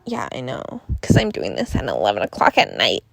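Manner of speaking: low, dry, fast mock reply; deep sarcastic agreement; deadpan reply. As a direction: drop way down and rattle it off. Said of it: "Which I'm like".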